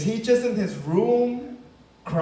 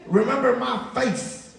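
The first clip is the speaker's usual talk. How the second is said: lightly voiced street tough, a touch higher; higher mock yell from a car window; mock gangbanger yell; 4 semitones higher than usual. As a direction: call out a threat from a passing car, hammed-up. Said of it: "just kept yelling".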